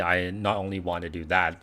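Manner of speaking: normal talking voice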